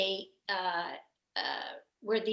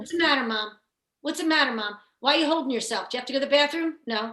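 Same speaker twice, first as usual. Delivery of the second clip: put-on higher, louder, nagging questions to a child; fussing grandmom; 4 semitones higher, 6 dB louder than usual. Grandmom questioning the children, fussing and raised. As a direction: imitate an insistent grandmother. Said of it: "my grandmom, she would call us".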